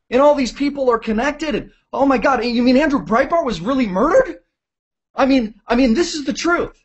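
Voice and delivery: high, breathless-with-disbelief imitation; mock astonished outburst; acted amazement. Acting out amazed viewers discovering news.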